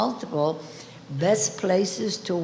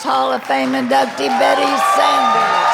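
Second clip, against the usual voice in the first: announcer call; 5 semitones higher, 8 dB louder than usual. Real, not acted. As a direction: bring her on like a ring announcer. Announcing inductee to stage.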